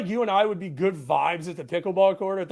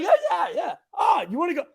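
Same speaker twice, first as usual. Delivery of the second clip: much higher, shouted bro excitement; 10 semitones higher, faster than usual. Retold or imitated. Bros hyping each other for a beer, high shout. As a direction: squeal it like a hyped buddy. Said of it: "everyone gets the high voice".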